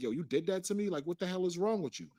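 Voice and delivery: heated retelling, voice rising; retold angry outburst. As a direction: drop the professional manner and confront her.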